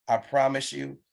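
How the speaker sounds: usual speaking voice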